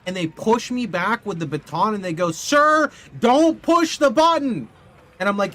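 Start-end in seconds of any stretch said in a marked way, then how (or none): 2.3-4.7 high, loud mock yell of the security guard. Security guard yelling at the streamer to stop, mock shout. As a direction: bark it like a panicked airport guard.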